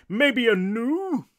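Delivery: high, slow, loud drawn-out guess; puzzled English ghost. A narrator's reading. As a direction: stretch the last word questioningly. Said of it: "what might have been an English accent".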